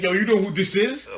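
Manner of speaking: loud, high challenge down the line; imitated phone confrontation. Boyfriend calling his rival, loud imitated challenge.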